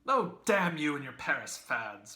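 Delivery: high, loud mocking dismissal; scornful. A narrator's reading. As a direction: sneer at the fads.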